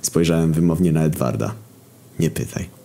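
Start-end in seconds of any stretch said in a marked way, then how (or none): 2.2-2.8 muttered aside. Acted. Edward brushes off question.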